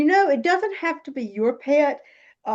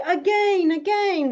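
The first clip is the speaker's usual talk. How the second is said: put-on higher, eager little girl's again, again; imitating a small granddaughter; 6 semitones higher, slower than usual. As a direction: echo a delighted child begging for more. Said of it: "with her little Canadian accent".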